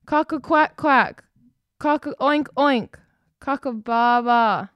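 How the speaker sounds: high, loud urgent crow; a cockerel raising the alarm; shouted alarm crow. Acted. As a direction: wake the whole farm. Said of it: "shouted Cockerel".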